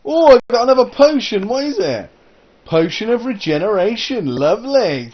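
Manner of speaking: high, loud thrill at getting "potion"; excited. Real, not acted. Player delighted by a lucky block potion. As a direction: gasp it out with real delight.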